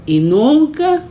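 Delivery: nunca rising high and loud; emphatic never. Real, not acted. Pastor stressing to the church that nobody ever speaks, nunca rising high.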